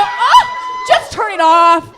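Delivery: high, loud, slow screaming order; full kid's tantrum shout, high and loud; child's furious shout. Retold or imitated. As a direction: scream it at your crying sister. Child screaming at crying sister.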